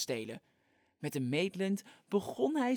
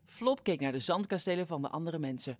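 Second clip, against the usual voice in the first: ordinary delivery, nothing marked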